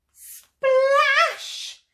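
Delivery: high, loud drawn-out SPLASH; big splash. A narrator's reading. Reader to children as T-Rex falls in, loud splash. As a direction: burst out the word.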